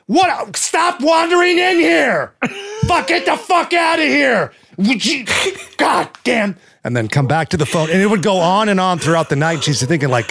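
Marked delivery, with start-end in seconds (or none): 0.1-6.6 much higher, slower hammed-up screaming; mock furious yell. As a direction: scream a mock tirade at someone barging in. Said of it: "hold on again".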